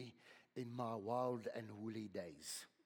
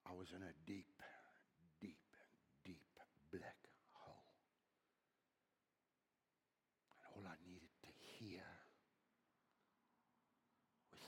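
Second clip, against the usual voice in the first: whispered, soft confession; hushed whisper; 5 semitones lower, 15 dB quieter than usual. Real, not acted. Preacher confides his darkest time to the church, whispered. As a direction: drop to a whisper, intimate. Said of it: "hushed intimate confession".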